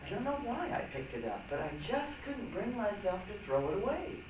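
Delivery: ordinary talk, nothing marked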